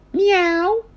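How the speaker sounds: very high, cartoon meow; cat meow. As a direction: meow like a kitten. Child imitates a cat.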